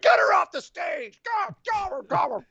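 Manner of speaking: high, yelled order to clear the stage; frantic shout. Retold or imitated. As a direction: shout it like you've lost your mind. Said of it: "Dustin Rhodes loses his mind".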